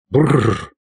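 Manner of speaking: acted shudder from the cold; shivering brr. Hero chilled to the bone outside the castle, shivering. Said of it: "Холодно, аж дрожь".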